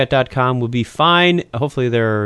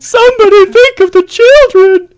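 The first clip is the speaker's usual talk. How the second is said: mock crying; 11 dB louder than usual.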